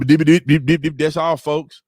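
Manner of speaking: mock stammering politician, cartoon sign-off; shaking politician. Streamer mocking American politicians sitting up there shaking, stammering cartoon impression. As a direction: stutter it out like a cartoon send-off. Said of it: "they're sitting up there shaking and".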